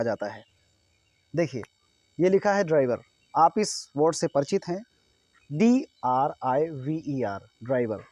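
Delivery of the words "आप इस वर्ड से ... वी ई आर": ordinary delivery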